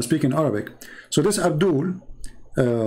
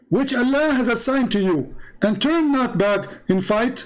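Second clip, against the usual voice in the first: higher-pitched mock recitation; raised, declaiming voice; imitated reciter; 7 semitones higher than usual. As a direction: proclaim the verse as the eager questioner. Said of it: "he says".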